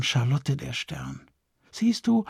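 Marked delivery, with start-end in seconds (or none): 1.7-2.2 higher sing-song fluting; lightly fluted reassurance. Acted. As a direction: flute it sweetly, much higher. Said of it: "flötete Maximiliane".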